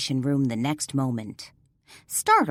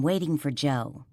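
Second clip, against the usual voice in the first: ordinary delivery, nothing marked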